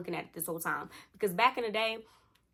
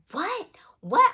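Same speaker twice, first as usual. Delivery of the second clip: shrill mock yell, much higher and louder; yelling What; 13 semitones higher, 11 dB louder than usual. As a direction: blast out What What like she can't believe it. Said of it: "Why are you yelling?".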